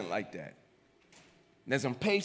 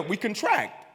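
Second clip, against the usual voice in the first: ordinary talk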